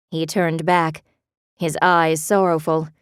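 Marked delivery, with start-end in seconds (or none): none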